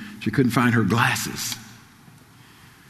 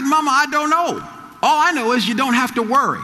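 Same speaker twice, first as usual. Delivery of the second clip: preaching at full force; 8 semitones higher than usual. Acted. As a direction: drive the reassurance home to the crowd. Preaching climax.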